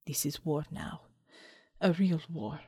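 low, hushed and grim; grim, quiet resolve. A narrator's reading. Commander to herself as comrades die, low grim quiet. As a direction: drop it low and soft, steeling yourself.

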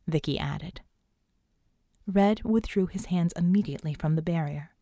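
ordinary delivery, nothing marked

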